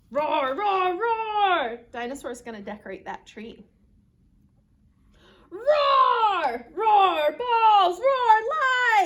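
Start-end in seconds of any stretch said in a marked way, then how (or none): 0.1-1.8 drawn-out announcement into loud roars; high, boisterous roars; dinosaur roaring. Acted. 5.5-9.1 very loud, high roars and action words; rowdy dinosaur shout; dinosaur roaring. Acted. Dinosaur roars while decorating.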